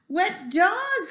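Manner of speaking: a shocked high cry. A narrator's reading. Fancy people at the lake crying out high at the dog. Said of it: "cried the people".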